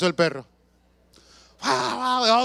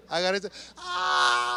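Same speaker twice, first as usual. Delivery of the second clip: mock battle cry; 12 semitones higher, 5 dB quieter, slower than usual. Swinging bag at the dog.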